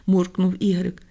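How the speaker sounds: usual speaking voice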